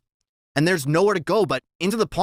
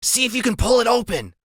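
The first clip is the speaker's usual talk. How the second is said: Freak's voice; 9 semitones higher than usual. A narrator's reading. Freak instructing.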